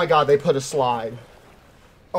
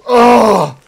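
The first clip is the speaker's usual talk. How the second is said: sudden loud yelp; startled cry; 8 semitones higher, 13 dB louder than usual. Real, not acted. Streamer yelping as the climber falls. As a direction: yelp as you slip.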